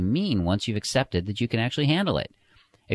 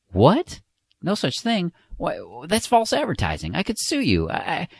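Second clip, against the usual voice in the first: much higher, outraged, sputtering protest; furious sputtering; 10 semitones higher than usual. A narrator's reading. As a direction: blurt it out in indignant disbelief. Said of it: "The man's furious by now".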